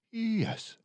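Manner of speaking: short high Mama reply; Mama's voice. A narrator's reading. Mama answering Boris, lightly voiced. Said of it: "Mama said".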